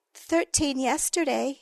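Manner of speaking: plain reading voice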